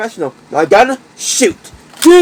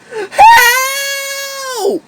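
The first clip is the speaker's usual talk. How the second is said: high, long shouted plea; cry for help; 13 semitones higher than usual. Acted. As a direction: call out desperately. Captured calf cries for help.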